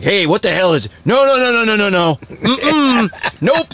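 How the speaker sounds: mock fussy dad saying no to daughter's song; old dad fart. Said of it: "that old dad fart vibe".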